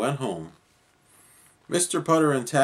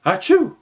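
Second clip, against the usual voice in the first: big high sneeze into the phone; a loud comic sneeze; 13 semitones higher, 10 dB louder than usual. A narrator's reading. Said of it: "He picked it up and said".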